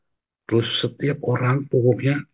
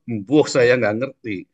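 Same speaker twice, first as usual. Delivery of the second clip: ordinary delivery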